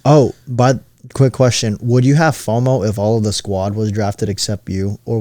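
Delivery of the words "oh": ordinary talk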